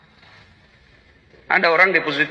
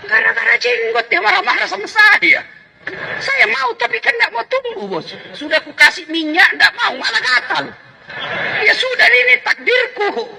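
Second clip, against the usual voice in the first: laughing; 14 semitones higher than usual. Real, not acted. Laughing about his beardlessness.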